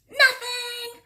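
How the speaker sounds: snatch it away with glee; gloating poltergeist. A narrator's reading.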